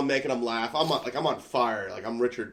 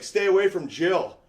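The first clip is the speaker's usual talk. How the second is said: louder, slow menacing warning; slow, higher mock-tough warning; tough threat; 8 semitones higher, 6 dB louder than usual. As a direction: lay down the threat.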